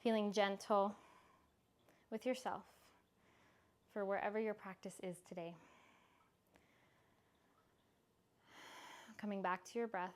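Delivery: soft calm closing voice; guided relaxation. Acted. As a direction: wind the class down gently. Soft closing of yoga class.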